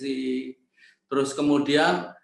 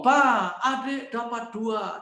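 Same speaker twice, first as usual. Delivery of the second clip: proud; 6 semitones higher, much slower than usual. Retold or imitated. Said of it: "Dengan bangga loh dia ngomong".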